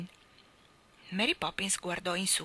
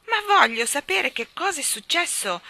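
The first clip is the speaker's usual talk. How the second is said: much higher, louder insistent cry; shouting; 9 semitones higher, 8 dB louder than usual. Acted. Boy shouting at his nanny to know what happened. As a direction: demand an answer, frantic. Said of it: "gridò il bambino".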